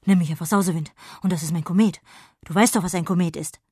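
a busy little sprite introducing himself; fairy Sausewind. Acted. Sausewind introduces himself to Jonna, brisk and loud.

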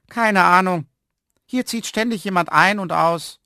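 high, loud woman's voice; dismissive woman. A narrator's reading. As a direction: brush the question off, high and loud. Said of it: "zuckte mit den Achseln und sagte". (abweisende Frau, hoch und laut)

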